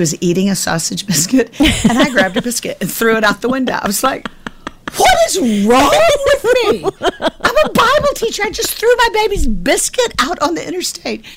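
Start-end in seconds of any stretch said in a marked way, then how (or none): 5.0-11.2 very high, squealed mock wail; flustered outburst. Mom who threw her daughter's biscuit out, hammed-up flustered wail. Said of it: "I was so flustered".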